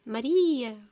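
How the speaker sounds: mock shout of a name, drawn out; calling out. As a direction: call it out loudly as if for an echo.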